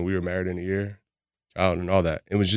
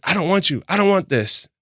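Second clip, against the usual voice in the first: imitating an upset partner, higher pitch; mock-indignant, raised pitch; retold angry rejection; 11 semitones higher, 5 dB louder than usual. Retelling partner rejecting him during a fight, mock angry.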